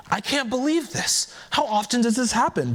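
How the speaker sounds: high, stunned disbelief; shocked. Acted. Rabbit reacts to the chicken killing in high shock. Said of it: "asked in shock".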